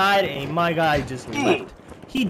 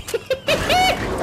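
laugh. Real, not acted. Laughs at money grab.

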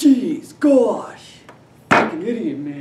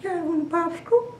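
Herbert the Pervert; 4 dB quieter, faster than usual. Retold or imitated. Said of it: "Herbert the Pervert".

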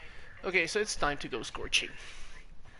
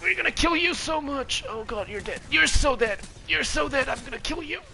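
panicking gamer; 8 semitones higher, 5 dB louder than usual. Real, not acted. Gamer taunting enemy.

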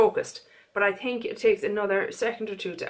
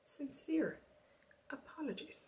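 hushed aside, barely voiced; whisper; 6 semitones higher, 18 dB quieter than usual. Real, not acted. A whispered apology to the viewers. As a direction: breathe it out under the breath.

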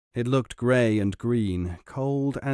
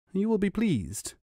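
fish voice; 7 semitones higher than usual. A narrator's reading. Flounder voiced.

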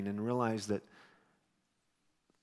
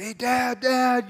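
put-on high, eager kid's call to his dad; excited call; 11 semitones higher, 6 dB louder, much slower than usual. Son greeting his dad on the playground, high and eager. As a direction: call out like a thrilled boy spotting dad.

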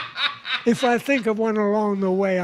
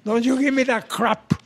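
put-on higher-pitched, Spanish-accented maternal scolding; scolding Spanish mama; 5 semitones higher than usual. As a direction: lay down the law as a Spanish-accented mother. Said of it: "his mama says".

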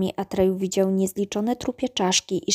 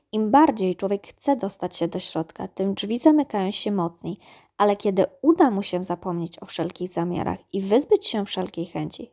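usual speaking voice

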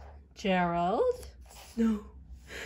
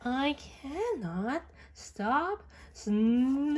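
usual speaking voice

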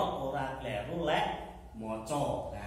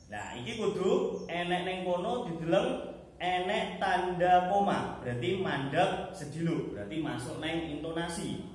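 usual speaking voice

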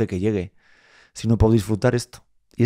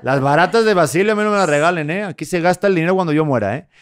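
grandfather demanding; 5 semitones higher, 4 dB louder than usual. Retold or imitated. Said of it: "Y llega él diciendo".

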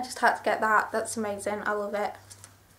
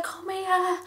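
mum beckoning; 8 semitones higher, 4 dB quieter than usual. Retold or imitated. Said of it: "She was like".

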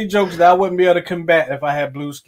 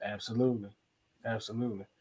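ordinary delivery

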